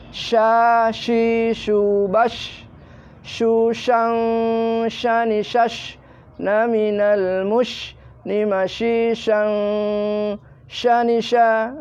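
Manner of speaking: chanted "shang" with thick SH and held NG; shin drill, lips forward. Shown as a demonstration. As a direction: push the lips out and draw out "Sha, shi, shu". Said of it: "bibir ke depan". (melantunkan "Sha, shi, shu" bibir ke depan)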